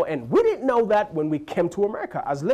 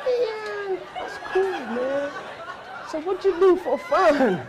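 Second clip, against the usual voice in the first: much higher, amazed dude voice; awed kid; 12 semitones higher, slower than usual. Retold or imitated. Gullible kid asking about fun in Africa, much higher awed dude. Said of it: "The kid's like".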